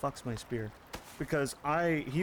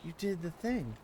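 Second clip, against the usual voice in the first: praising a pet; 6 semitones higher than usual. Acted. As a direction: gush over her like a puppy.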